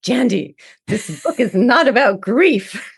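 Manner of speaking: Guillermo's voice. Retold or imitated. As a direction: play the imagined mentor setting her straight. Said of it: "he had this voice, his, like, voice and his accent".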